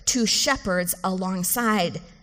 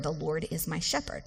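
intimate confession; 4 semitones lower, 8 dB quieter than usual. Shown as a demonstration. Preacher voicing a believer's intimacy with God, soft and tender. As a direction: murmur it like a private confession of love. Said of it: "a confession of your intimacy with the Lord".

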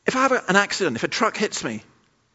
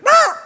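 loud, very high revived-chicken squawk; piercing imitated cluck; 10 dB louder than usual. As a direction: burst back to life as a squawking chicken. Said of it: "my chicken went".

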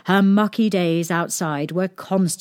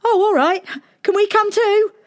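very high, louder eager voice; eager guards; 14 semitones higher, 4 dB louder than usual. Acted. Palace guards ask princess to come along, eagerly. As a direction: pipe up high, keen to join in.

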